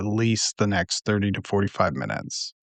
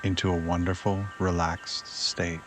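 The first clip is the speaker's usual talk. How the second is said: guided meditation; 3 semitones lower, 6 dB quieter than usual. Acted. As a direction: ease the listener toward sleep, unhurried and hushed. Leads a nighttime meditation.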